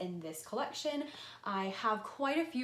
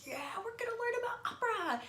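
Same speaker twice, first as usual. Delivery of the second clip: excited whisper; 13 semitones higher than usual. Retold or imitated. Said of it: "so I was like".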